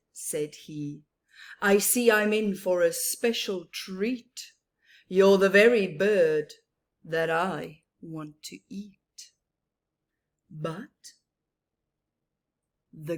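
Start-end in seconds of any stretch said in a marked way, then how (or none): 1.6-9.3 menacing Get You bird. Acted. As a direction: greet her like a hungry hunter.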